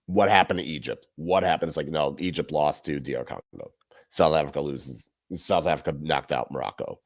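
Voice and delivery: usual speaking voice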